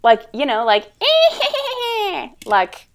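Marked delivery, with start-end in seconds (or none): 0.9-2.4 high shrieking witch laugh; witch cackle. Retold or imitated. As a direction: cackle like Miss Gulch. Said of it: "That was very good".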